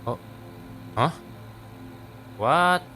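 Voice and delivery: ordinary talk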